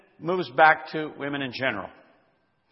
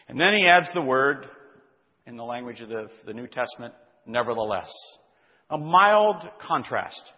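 ordinary talk, nothing marked

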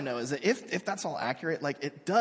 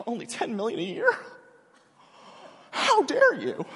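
mock indignant huff, jumping higher on dare; mock entitled outrage; 6 semitones higher than usual. Mocking his entitled generation in a huffy, indignant voice. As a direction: scoff at the sum, then squeal in outrage. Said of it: "they're like".

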